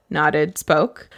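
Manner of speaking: ordinary delivery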